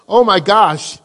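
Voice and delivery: ordinary delivery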